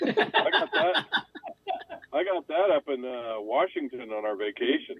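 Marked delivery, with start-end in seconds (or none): 0.0-1.1 laughing high and slow; a laugh breaking through the words. Real, not acted. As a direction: chuckle it out at the memory. Laughing about the ticket.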